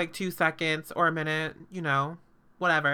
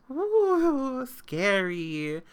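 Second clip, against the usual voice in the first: mock scared; 6 semitones higher, 4 dB louder, much slower than usual. Sarcastic mock fright at body parts.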